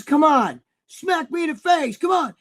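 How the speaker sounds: high, loud goading shout; retold shout. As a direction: bark the dare like a hyped lifter. Retold gym dare, shouting.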